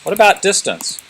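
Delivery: ordinary talk, nothing marked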